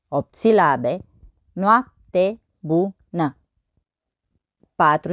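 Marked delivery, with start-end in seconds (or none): 1.5-3.4 very slow, each syllable separated. Shown as a demonstration. Teacher counting verse syllables on fingers, syllable by syllable. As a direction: count out the syllables one at a time. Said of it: "Să observăm numărând".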